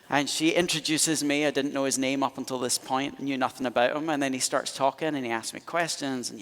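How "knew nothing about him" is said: normal talking voice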